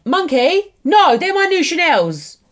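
high, loud dismayed telling-off. Real, not acted. Mum to her toddler touching her new shoes, a loud high telling-off.

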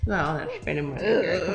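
lightly voiced old man; a hint of a low old man. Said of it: "that's like my old man voice".

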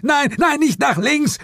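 very high warning yell; panicked, high-pitched shout; frantic scream. A narrator's reading. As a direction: scream to stop his wrong move. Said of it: "schrie Suko".

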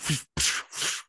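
quick airy bursts of whooshing; imitated magic whooshes. Game master showing magic striking creatures, airy whooshes. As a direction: make whooshing noises for flying flashes of light.

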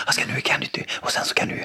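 unvoiced whispered aside. Dad whispering plans to his kids, hushed whisper. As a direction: whisper it conspiratorially.

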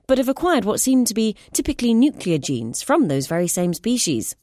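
ordinary delivery, nothing marked

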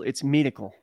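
medical said as "midical", ee vowel; "medical" with first vowel like meed; Kiwi pronunciation. Shown as a demonstration. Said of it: "if you say medical".

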